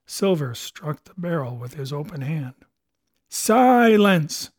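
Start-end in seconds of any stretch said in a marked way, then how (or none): 3.3-4.5 loud, higher roar of Silence. A narrator's reading. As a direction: bellow the order to silence the crew. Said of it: "he roared".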